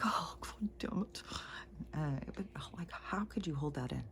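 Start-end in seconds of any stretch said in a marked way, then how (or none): 0.0-1.2 high, slow tearful wail, acted out; re-enacted weepy exasperation, drawn out; imitated crying. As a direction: whimper the curse as if crying. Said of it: "And then I'm crying".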